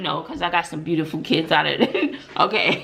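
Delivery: amused, laughing while speaking. Real, not acted. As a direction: talk through the giggles. Laughing at own joke.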